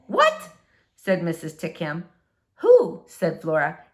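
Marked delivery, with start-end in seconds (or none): 0.1-0.5 very high startled what; high startled neighbor; baffled what. Acted. Missus Tickham baffled. 2.6-3.0 high, louder who; high sharp who; quick question. Acted. Flora corrects her.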